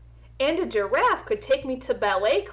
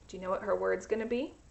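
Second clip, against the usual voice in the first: put-on softer, fast question to kids; soft quick aside; 2 semitones lower, 6 dB quieter, much faster than usual. Teacher asking the class, softer and fast. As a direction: lean in and ask the children.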